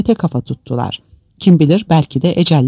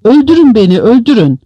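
loud, high-pitched desperate cry; heartrending yell; 8 semitones higher, 6 dB louder than usual. A narrator's reading. Prisoner pounding the concrete begs for death, heartrending yell. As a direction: cry out in anguish, begging. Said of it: "canhıraş bir bağırtı izledi".

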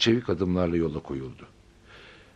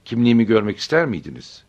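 loud, stern counter-question; a touch of icy severity, louder; hard, cold retort; 3 semitones higher, 7 dB louder than usual. A narrator's reading. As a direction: answer back firmly and coldly. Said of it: "aynı sertlikte ve soğuklukta bir tonda".

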